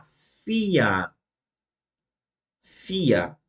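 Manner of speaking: "vier" starting with F, ending in A. Shown as a demonstration. Teacher modelling "vier" with F sound and final A. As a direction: say "vier" as "fia". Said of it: "vai ter som de A".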